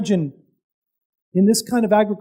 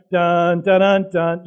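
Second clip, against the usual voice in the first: sung-spoken dun dun dun dun; mock crescendo music; 4 semitones lower, slower than usual. Shown as a demonstration. Preacher mimics movie music to build suspense, mock drum. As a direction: hum the dramatic movie beat. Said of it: "the music is crescendoing".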